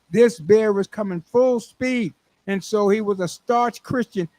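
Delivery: ordinary talk, nothing marked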